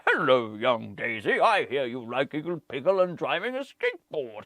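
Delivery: put-on grandfatherly Christmas voice; Father Christmas. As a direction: greet the child like a storybook Santa. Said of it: "Father Christmas then says".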